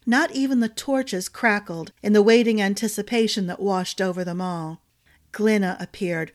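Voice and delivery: ordinary delivery, nothing marked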